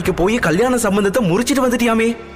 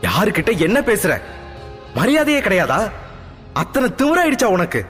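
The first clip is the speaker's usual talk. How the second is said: high, fast scolding yell; mother yelling; 8 semitones higher than usual. Acted. Mother yells at her insolent daughter, high fast scolding. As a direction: screech at the rude daughter, rising. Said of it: "கத்தினார்".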